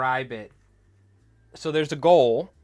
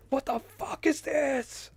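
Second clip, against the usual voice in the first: slow, very high squeal of shock; panicked high squeal; slower than usual. Retold or imitated. Replaying his hilarious reaction to the coach, a slow high squeal. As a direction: drag it out in a shrill freak-out. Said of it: "my reaction to it was fucking hilarious".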